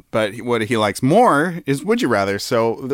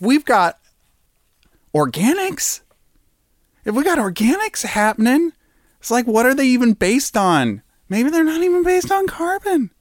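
excited wonder; 13 semitones higher than usual. Real, not acted. Excited speculation rising.

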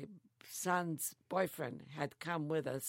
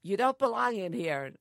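raised, commanding voice; loud stern order; 6 semitones higher, 10 dB louder than usual. Retold or imitated. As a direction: bark it like a soldier. Retold GI ordering her out.

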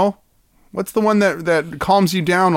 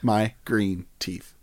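quieter and slower, savouring it; soft slow murmur; 5 semitones lower, 7 dB quieter, slower than usual. Pretend. A friend repeats the druid's delight in a soft, slow murmur. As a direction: let the phrase fade out softly. Echo trailing off.

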